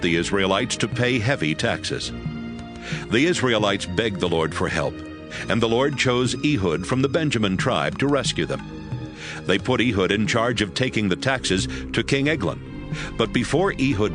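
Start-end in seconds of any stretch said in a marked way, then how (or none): none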